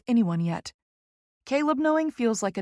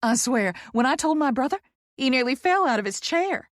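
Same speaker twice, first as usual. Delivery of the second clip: high, excited Southern gush; Poppy, effusive Southerner; 5 semitones higher, 4 dB louder than usual. Acted. Poppy telling of a brother's reaction, excited Southern voice. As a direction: gossip it with lively emphasis.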